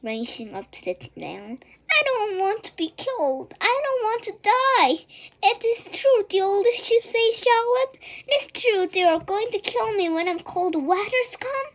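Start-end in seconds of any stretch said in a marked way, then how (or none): 2.0-11.7 high, loud desperate pleading; panicked wail. A narrator's reading. Wilbur pleading with Charlotte, a high frightened wail.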